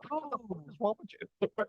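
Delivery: high, soft put-on dog voice; scolding dog. Voicing the dog's scolding.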